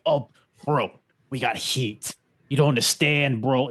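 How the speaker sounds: lightly voiced brash wrestler; a hint of a cocky wrestler; Bubba Dudley impression. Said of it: "this is Bubba Dudley".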